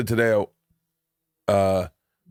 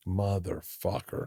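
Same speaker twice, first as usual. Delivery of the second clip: slow, much softer curse under the breath; muttered dismay; 3 semitones lower, 9 dB quieter, much slower than usual. Retold or imitated. Seeing his weight on the scale, a slow soft muttered curse. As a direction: let it fall out, low and defeated.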